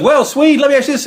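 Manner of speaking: high-pitched mock announcer; eager interviewer voice, played high; imitated interviewer. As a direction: pitch it up to pose the question. Imitating the interviewer addressing Swede.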